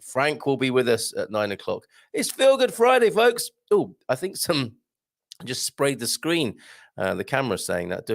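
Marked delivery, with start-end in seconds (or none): 2.1-3.5 high, bright show-intro call; big announcer catchphrase. Acted. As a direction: sing out the catchphrase like a radio jingle.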